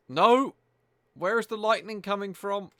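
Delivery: high, loud protest of disbelief; alarmed outcry. Real, not acted. Gamer caught by lightning in a lagging game, alarmed outcry. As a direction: cry out high in real alarm.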